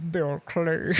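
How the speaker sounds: mangled mock American accent; bad American accent. Hans gives John his false name, awful American accent. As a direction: badly fake an American accent. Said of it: "but worst accent".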